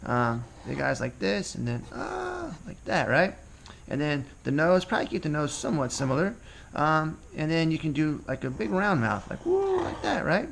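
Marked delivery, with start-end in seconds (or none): 1.9-2.6 a long, high drawn-out gasp of surprise; slow high "ah" showing a surprised face. Shown as a demonstration. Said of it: "when they're surprised or excited". 9.4-9.8 a sudden very high "woo"; surprised woo. Shown as a demonstration. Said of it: "That would be surprised".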